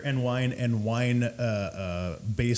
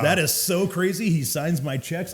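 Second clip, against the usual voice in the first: higher, mock-impressed sarcasm; sarcastic amazement; 6 semitones higher, 4 dB louder than usual. Bartender answering a name-dropper, sarcastic amazement. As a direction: feign wonder to deflate a boast.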